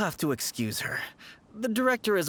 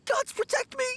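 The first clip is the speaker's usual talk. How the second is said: high, panicked plea; terrified cry. Real, not acted. [name] begs for protection from the floating ghost, terrified high cry. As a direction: cry out to the gods in terror.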